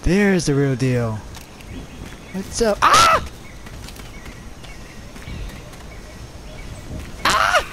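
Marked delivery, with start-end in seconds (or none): 2.8-7.7 jump-scare yelp, way up high; shriek. Real, not acted. Gamer caught off guard, startled shriek. Sudden attack in game.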